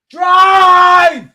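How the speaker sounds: very high, very loud shout; all-out yell of drive; full scream. Real, not acted. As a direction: scream the word at the telly. Screaming at player to run.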